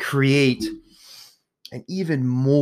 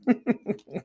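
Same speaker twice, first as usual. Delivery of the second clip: self-mocking chuckle; short, high giggle; 10 semitones higher, 5 dB quieter than usual. Real, not acted. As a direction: laugh quietly at yourself. Laughs at own self-mockery.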